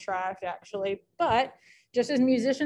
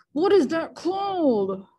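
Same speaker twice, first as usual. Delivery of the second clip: louder, higher, drawn-out "what is that called"; put-on exasperation; 7 semitones higher, 5 dB louder, slower than usual. Teacher stumped while Googling a meter term, mock-exasperated drawn-out question. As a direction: wail the question slowly in a silly voice.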